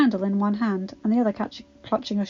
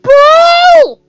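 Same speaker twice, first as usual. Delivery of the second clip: anguished scream; 18 dB louder than usual. A narrator's reading. Adam's final nightmare scream.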